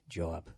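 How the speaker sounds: "job" said as jaw plus b; Boston pronunciation. Shown as a demonstration. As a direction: round the vowel of "job" Boston style. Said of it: "just say, like, jaw".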